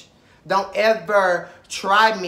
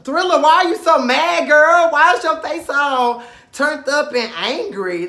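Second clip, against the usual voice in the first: high, loud mock call-out; hammed-up shout at a sulking castmate; mock confrontation; 9 semitones higher, 9 dB louder than usual. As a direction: yell it at Thriller, pitch up high. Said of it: "you just see Thriller face just".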